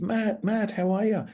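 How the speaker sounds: high, bright-voiced call of his name, imitating her; mock eager hello as she runs up; excited greeting. Young woman rushing up to greet Matt, high and excited.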